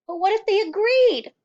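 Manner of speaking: very high, loud hopeful burst; sudden hope; eager cry. A narrator's reading. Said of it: "Matilda cried eagerly".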